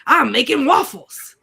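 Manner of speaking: loud, gleeful movie-quote shout; hammed-up exclamation; exuberant quote. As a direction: belt it out like an excited cartoon sidekick. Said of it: "my, like, verbal tick response is".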